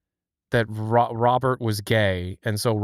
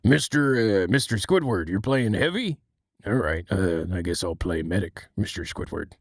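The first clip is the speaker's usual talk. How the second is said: serious Mister Krabs; 5 semitones lower than usual. Retold or imitated. Krabs agreeing to play medic for Squidward, low. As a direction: keep it low and earnest.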